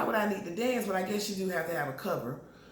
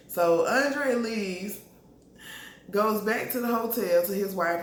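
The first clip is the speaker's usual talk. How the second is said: ordinary talk, nothing marked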